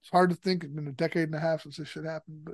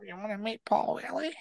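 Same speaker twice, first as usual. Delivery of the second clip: softer, luring imitation of a friend; changed tune, coaxing; 3 semitones higher, 7 dB quieter than usual. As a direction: dangle the offer like bait. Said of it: "he changed his tune up immediately".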